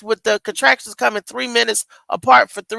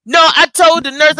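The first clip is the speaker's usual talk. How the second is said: usual speaking voice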